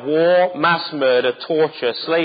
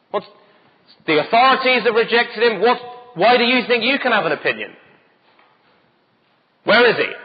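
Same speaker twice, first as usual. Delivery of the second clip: mock scorn; 8 semitones higher, 3 dB louder than usual. Voicing the elite's scorn.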